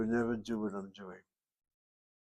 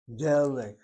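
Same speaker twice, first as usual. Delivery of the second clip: delik with a louder first syllable, DE-lik; stress on first syllable; 6 dB louder than usual. Shown as a demonstration. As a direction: punch the de of delik. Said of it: "Notice where the accent is".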